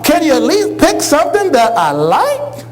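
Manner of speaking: put-on high, pleading child voice; whiny son. Grown son whining high to his mother about vegetables. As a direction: whine it up like a kid.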